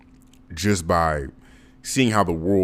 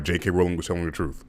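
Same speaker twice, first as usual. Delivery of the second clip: ordinary delivery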